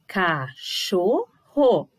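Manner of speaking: "Cachorro" broken into ca, cho, rro with pauses; very slow, each syllable of "Cachorro" on its own. Shown as a demonstration. Teacher showing syllable separation, slow and split. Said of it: "separação especial de sílabas".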